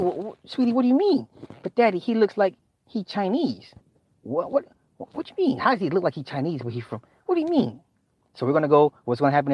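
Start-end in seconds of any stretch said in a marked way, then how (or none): 0.0-7.8 high, soft, rattled questions; a dad trying to stay calm; dad grilling his kid, higher and quieter; alarmed dad. Retold or imitated.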